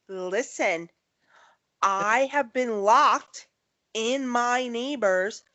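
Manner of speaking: very slow, each word spelled out; slow insistent explanation. Pretend. Caller re-explaining to emergency operator, slow and deliberate. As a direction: spell it out word by word, mock-patient. Imagined call to emergency line.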